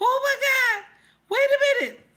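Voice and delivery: high-pitched, loud squeal of disbelief; shocked disbelief. Acted. Host reacting to squirrel counting, high shocked disbelief.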